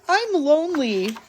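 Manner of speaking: high, plaintive small voice; lonely little mouse. A narrator's reading. Little Mouse crying to Big Mouse, high lonely voice. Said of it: "he cried".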